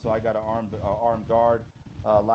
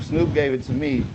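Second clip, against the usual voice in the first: normal talking voice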